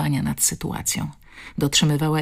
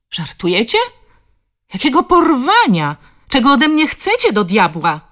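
much higher, louder outraged protest; outraged disbelief; 8 semitones higher, 6 dB louder than usual. Acted. Suspect confronted by police, higher and outraged. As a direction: throw the accusation back at them.

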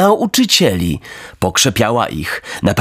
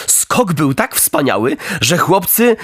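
plain reading voice

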